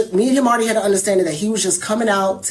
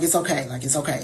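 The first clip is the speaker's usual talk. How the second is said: soft reassurance; 8 semitones lower, 7 dB quieter than usual. Retold or imitated. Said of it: "I was like".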